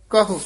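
ਕਹੋ with the last vowel kept short; don't lengthen the o of ਕਹੋ; short final vowel. Shown as a demonstration. Teacher correcting the vowel, ਕਹੋ ending short. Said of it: "ਹੋੜਾ ਦੀਰਘ ਨਹੀਂ ਲਾ ਜਾਣਾ".

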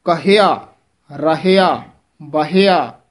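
ਕਹਿਆ as "ka-he-aa"; careful three-syllable ਕਹਿਆ, item by item; full h syllable. Shown as a demonstration. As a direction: sound the h clearly in ਰਹਿਆ and ਬਹਿਆ. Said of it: "ਇਸ ਤਰ੍ਹਾਂ ਇਹਨਾਂ ਪਦਾਂ ਦੀ ਮਿਹਨਤ".

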